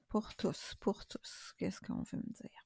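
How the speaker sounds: very soft unvoiced whisper; hushed, repeated whisper while concentrating. Real, not acted. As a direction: whisper it to yourself, asking for guidance. Murmurs to herself while drawing cards.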